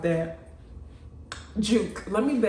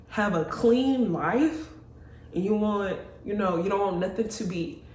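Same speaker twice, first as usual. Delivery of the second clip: ordinary talk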